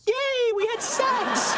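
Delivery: very high, drawn-out celebration; gleeful cheer. Pretend. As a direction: cheer in a squeaky put-on voice. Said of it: "It would say".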